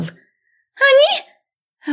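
очень высокий вскрик ученицы; взвизгни тоненько; девичий визг. A narrator's reading. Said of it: "Кто-то из адепток-ведьмочек завизжал". (визгливо, на самом верху голоса)

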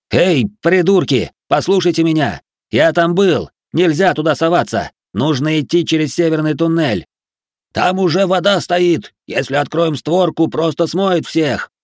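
high, loud call over a crowd; shouted warning. A narrator's reading. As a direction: urgently yell to make the crowd listen.

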